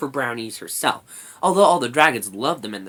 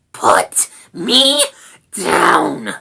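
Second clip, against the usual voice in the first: high, loud snarl, falling at the end; angry little rat demanding release; 5 semitones higher, 11 dB louder, much slower than usual. A narrator's reading. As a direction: snarl each word as an order. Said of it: "snarled Rat".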